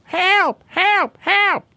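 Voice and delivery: high squawky mock cries of help; bird crying for help. Host imitating a peacock's call, high mock squawk. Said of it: "they're, like, going".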